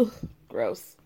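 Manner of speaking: low, soft grossed-out groan; disgusted. Real, not acted. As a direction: recoil in disgust. Disgust after acted confession.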